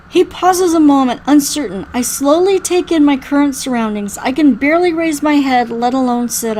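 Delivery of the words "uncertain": ordinary delivery, nothing marked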